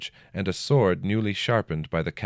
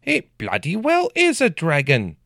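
much higher and louder, insistent; shouting; 10 semitones higher, 5 dB louder than usual. A narrator's reading. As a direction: hurl it back at the counselor. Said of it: "he shouted".

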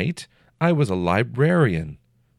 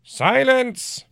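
sharp high shout, much louder; 5 dB louder than usual. A narrator's reading. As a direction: cut him off hard. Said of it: "Priquet shouted".

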